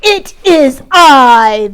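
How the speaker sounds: slow, loud, grand announcement; slow proud boom; big goat announcing. Acted. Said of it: "the biggest billy goat announced".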